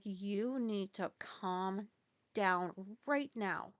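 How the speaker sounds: slow, deliberate mock scolding; imitated slow, measured command; retold stern order. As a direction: space the words out slowly, imitating a firm telling-off. Retold worker orders vet to calm down.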